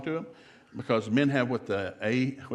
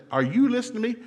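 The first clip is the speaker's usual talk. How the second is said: high, loud mock wife; a fed-up wife demanding attention; exasperated wife; 11 semitones higher, 6 dB louder, faster than usual. Wife confronting reading husband.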